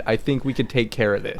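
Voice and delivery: normal talking voice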